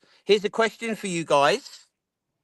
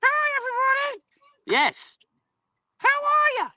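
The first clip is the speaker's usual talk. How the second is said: very high squeaky puppet voice; Marvin voice. Pretend. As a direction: squeak like a tiny bug. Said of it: "Marvin, hello".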